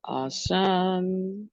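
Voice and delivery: ordinary talk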